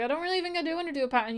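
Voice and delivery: high, fast, squeaky reluctance; mock reluctant squeal of a reply; reluctant brush-off. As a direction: rush it out high, dodging the request. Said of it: "And I was like".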